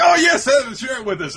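high, loud eager gushing; eager girls. Retold or imitated. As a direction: beg for cake, squealing. Girls begging for the cake.

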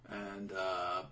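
normal talking voice

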